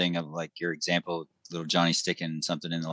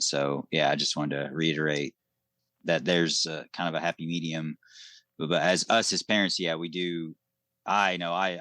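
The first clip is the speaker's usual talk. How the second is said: ordinary talk, nothing marked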